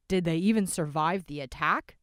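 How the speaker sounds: loud rough question; a rough man asking loudly. A narrator's reading. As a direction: demand it gruffly. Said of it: "the rough voice asked".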